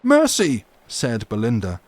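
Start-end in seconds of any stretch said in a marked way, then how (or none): 0.0-0.6 much higher and louder plea; panicked cry. Acted. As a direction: beg for mercy in alarm. Belinda panicking at the swords.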